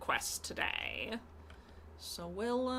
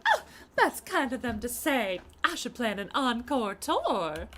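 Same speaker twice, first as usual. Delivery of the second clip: character voice; 3 semitones higher, 6 dB louder than usual. Acted. Reads game dialogue aloud.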